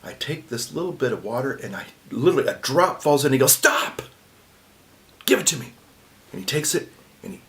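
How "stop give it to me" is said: sharp stop. Retold or imitated. Priest halting, then demanding.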